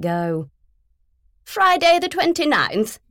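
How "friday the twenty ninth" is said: high, loud and grand; posh woman. Acted. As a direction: reel off the date loftily. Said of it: "Philomena went on".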